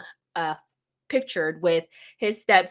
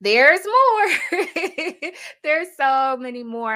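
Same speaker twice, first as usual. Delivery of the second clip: much higher and louder, gleeful; gleeful high announcement to viewers; excited tease; 9 semitones higher, 4 dB louder than usual. Real, not acted. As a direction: build the hype for more. Teasing more examples.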